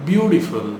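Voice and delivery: Beautiful as BYOO-di-ful, pitched high; expressive pronunciation. Shown as a demonstration. Teacher drilling the compliment, expressive pronunciation. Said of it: "Start saying it".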